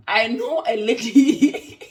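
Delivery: slow, loud, laughing through it; can't hold back the laugh. Real, not acted. Telling viewers about the tea-loving lady, laughing loudly.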